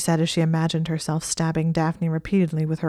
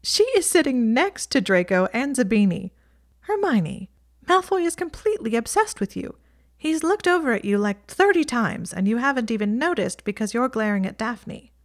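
put-on higher, exasperated girl's voice; Ginny exasperated; 6 semitones higher than usual. A narrator's reading. Ginny telling Hermione that Malfoy is obsessed with her, exasperated and higher. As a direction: roll your eyes at a jealous friend.

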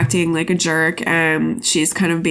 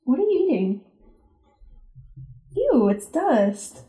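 higher, disgusted scolding of a dog; high pet-scolding voice; 4 semitones higher than usual. Real, not acted. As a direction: talk down to the dog, grossed out. Talking to the chewing dog.

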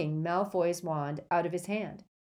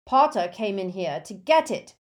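louder, impatient explanation; ill-disguised impatience; 3 semitones higher, 8 dB louder than usual. A narrator's reading. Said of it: "with ill-disguised impatience".

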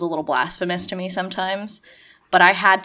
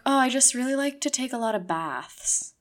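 lightly higher, airy feigned innocence; mock innocent; 6 semitones higher, 4 dB quieter than usual. To outsiders, a lightly voiced mock-innocent cover story. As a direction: play it breezy, hiding the witchcraft behind baths.

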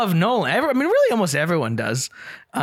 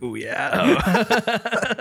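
impressed ooh; 6 semitones higher than usual. Retold or imitated. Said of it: "and I was like".

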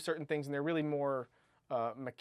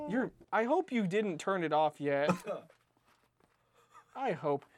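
ordinary delivery

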